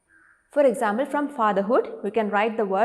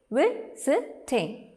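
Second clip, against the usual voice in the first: "Visiting" drawn out in three separate beats; syllable by syllable; 3 semitones higher, much slower than usual. Shown as a demonstration. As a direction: say "Visiting" slowly as vi, si, ting, pausing between beats. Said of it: "Three beats you heard".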